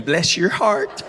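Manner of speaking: slow, sugary "Bless your heart"; mock Southern lady politeness; sweet Southern dig. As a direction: sweetly deliver a veiled insult. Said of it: "just throw in".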